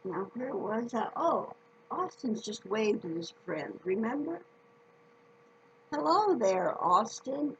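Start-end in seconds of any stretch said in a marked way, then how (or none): 5.9-7.6 loud, high friendly hail across the yard; calling out. Acted. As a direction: call out cheerfully to a friend. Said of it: "Abe calls out".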